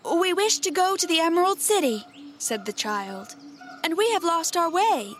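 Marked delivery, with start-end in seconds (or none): 0.0-2.1 put-on higher storybook little-girl voice; Dorothy addressing a king, pitched up; child's voice. A narrator's reading. 3.8-5.1 put-on higher storybook little-girl voice; Dorothy addressing a king, pitched up; child's voice. A narrator's reading.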